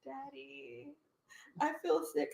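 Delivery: lightly acted sick child calling dad; fake sick. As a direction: play sick to get picked up. Faking sickness to her dad.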